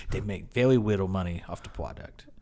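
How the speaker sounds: halting, earnest explainer with w's for r's and l's; imitated cashier. Cashier explaining the business to a customer, imitated. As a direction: mimic a clerk carefully laying out his trivia. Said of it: "he said".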